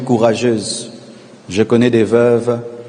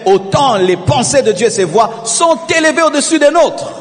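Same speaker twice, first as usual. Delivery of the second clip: preaching at full force; 10 semitones higher than usual. Acted.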